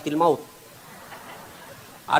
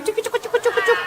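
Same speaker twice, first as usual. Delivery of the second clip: jingling imitation.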